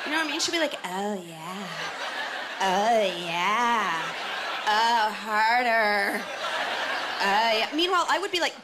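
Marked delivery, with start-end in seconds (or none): 0.8-7.7 low, drawn-out mock pleasure; fake happy sounds. Woman in a porno despite unpleasant things, mock happy moaning. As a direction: imitate a porn actress's put-on enjoyment. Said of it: "she'll be making happy sounds".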